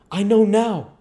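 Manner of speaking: put-on high, loud burst of a boy's realization; sudden shout of discovery; excited exclamation. A narrator's reading. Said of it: "exclaimed Tom".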